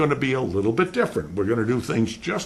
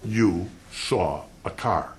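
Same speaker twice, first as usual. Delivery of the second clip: YOU, SAW, A CAR spaced out slowly; measured word-by-word repeat of YOU SAW A CAR; slow tweak; 3 semitones lower, slower than usual. Shown as a demonstration. Lawyer tweaks the witness before a jury, slow and deliberate. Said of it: "Now I tweak 'em".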